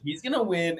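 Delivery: ordinary talk, nothing marked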